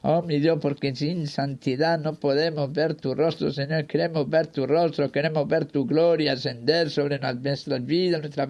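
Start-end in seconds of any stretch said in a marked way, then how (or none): none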